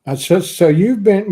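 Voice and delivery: usual speaking voice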